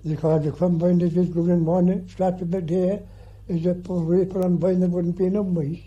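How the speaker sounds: ordinary talk, nothing marked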